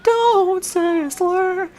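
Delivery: very high, very slow teasing voice; drawn-out falsetto tease; singsong warning. Pretend. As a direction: stretch it out high like a mock scold.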